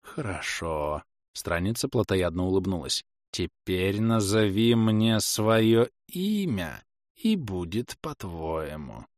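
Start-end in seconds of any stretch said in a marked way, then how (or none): 0.0-1.0 low, slow, soft assent; inhuman dark figure. Acted. Dark figure agreeing to the wish, low slow voice. 3.3-9.1 very slow, eerie demand; inhuman dark figure. Acted. Dark figure demanding the old woman's name, slow eerie voice.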